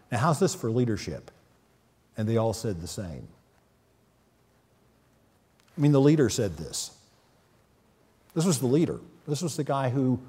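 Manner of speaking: ordinary talk, nothing marked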